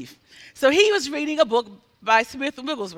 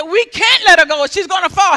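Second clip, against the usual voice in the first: loud, high objection; alarmed protest; 7 semitones higher, 10 dB louder than usual. Retold or imitated. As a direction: protest urgently to the healer. Helpers protest to healer.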